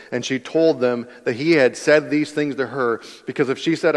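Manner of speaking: normal talking voice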